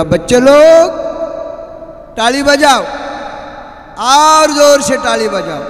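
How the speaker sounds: loud, high street-showman call in Hindi; snake charmer's call. Retold or imitated. Snake charmer calling the children to clap, loud and high. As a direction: bark it out like a fairground snake charmer. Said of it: "तो गारुडी ओरडत होता".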